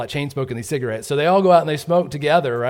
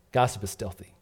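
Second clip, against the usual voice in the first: ordinary delivery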